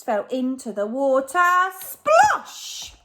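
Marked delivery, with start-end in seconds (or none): 1.4-3.0 splash word. A narrator's reading. Sound word in story.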